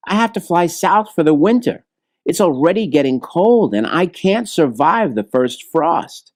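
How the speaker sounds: a small bird fretting about the cold; a hint of a worried little bird; bluebird. Bluebird pleads to leave.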